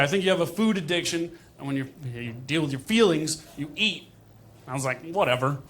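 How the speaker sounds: ordinary delivery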